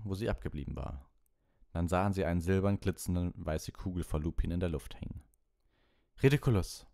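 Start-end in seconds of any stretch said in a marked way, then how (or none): none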